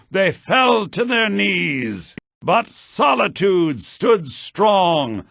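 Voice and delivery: loud, slow dramatic verse reading; poem recital. Acted. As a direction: declaim the poem to a hall.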